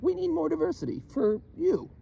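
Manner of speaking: high, teasing, sing-song line; mock high voice. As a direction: parody it high and mocking. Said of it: "I'm like".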